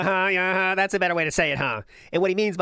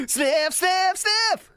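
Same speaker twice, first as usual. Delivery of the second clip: hyped shouting; slower than usual. Acted. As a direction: hype it up like a game show host. Hyping sniff like a game show.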